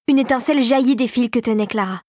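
usual speaking voice

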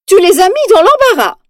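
high, loud stern reproach. Acted. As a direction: scold her sharply. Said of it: "sur un ton sévère".

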